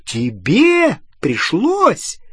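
very high, slow, incredulous screech; rising indignation. A narrator's reading. Hermione outraged at Ron's self-pity, voice climbing. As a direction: swoop up sharply on each word. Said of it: "Голос у Гермионы всё повышался".

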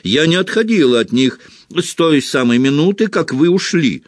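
high, indignant protest; affronted matron defending herself; offended. A narrator's reading. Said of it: "оскорблённо ответила мадам Помфри".

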